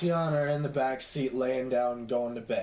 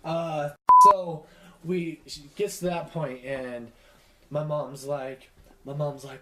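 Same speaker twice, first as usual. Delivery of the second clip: usual speaking voice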